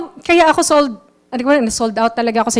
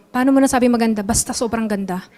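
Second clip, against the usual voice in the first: softer, mumbled back-and-forth with no conviction; mock clueless buyer exchange; 4 semitones lower, 7 dB quieter, faster than usual. Shown as a demonstration. Seller shows the wrong way to answer a customer, mumbled and much softer. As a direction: play both sides of a weak pitch, trailing quietly. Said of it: "Hindi ka ganun magsalita".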